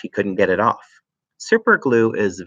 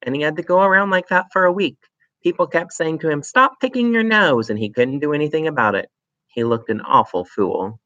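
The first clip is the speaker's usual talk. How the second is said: put-on sly child voice; 7 semitones higher than usual. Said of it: "Matilda said".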